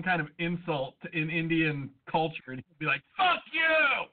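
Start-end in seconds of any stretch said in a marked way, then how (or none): 3.2-4.1 mock outburst. Imagined insulted agent.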